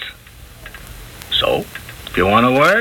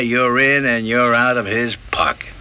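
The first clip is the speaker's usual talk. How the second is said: usual speaking voice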